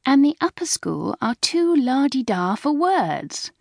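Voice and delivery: high, drawn-out mocking la-di-da voice; slow, high-pitched younger-sister jibe; eager retort. A narrator's reading. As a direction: tease back with exaggerated airs. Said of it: "eager to retaliate".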